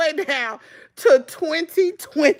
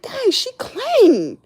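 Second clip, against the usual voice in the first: puzzled; 4 semitones higher, slower than usual. Retold or imitated. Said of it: "I'm like".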